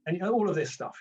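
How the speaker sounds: ordinary talk, nothing marked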